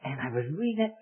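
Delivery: ordinary talk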